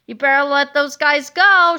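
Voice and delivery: loud, commanding warning; heroic shout. Acted. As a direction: yell it at the giant villain. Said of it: "shouted Captain Underpants".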